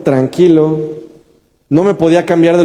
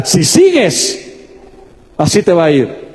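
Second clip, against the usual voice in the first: acted threat, first words high and drawn out; 3 semitones higher, slower than usual. Enemy directly threatening the believer, menacing. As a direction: stretch the warning, then drop to a level finish. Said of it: "hacernos una amenaza directa".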